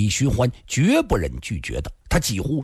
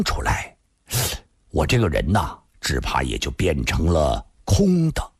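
low, mildly rueful man's voice; wry, bitter chuckle; 8 semitones lower than usual. A narrator's reading. Li accepting he needs steaming, mildly rueful. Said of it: "苦笑说道".

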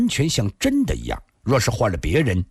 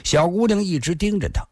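ordinary delivery, nothing marked

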